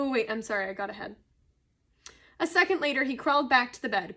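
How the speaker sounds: plain reading voice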